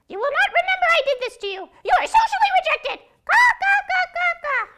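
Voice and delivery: very high, loud, fast screech; high screaming crow; shrieking crow. Acted. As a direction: shriek then caw. Crows shouting.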